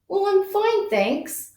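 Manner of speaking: put-on a light small child's reply, fading off; voicing the child's answer. Teacher voicing Amir's answer: lightly voiced child. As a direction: speak for Amir in a mild kid voice. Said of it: "I was the one making the voice for him".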